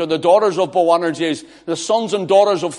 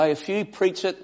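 ordinary talk, nothing marked

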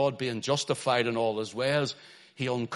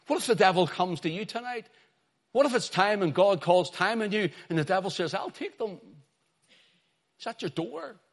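high-pitched, impassioned pulpit questioning; preacher's searching challenge, pitched high; urgent preaching appeal; 9 semitones higher, 4 dB louder than usual. Real, not acted. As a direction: press the congregation with rising urgency. Preacher presses the congregation with urgent appeal.

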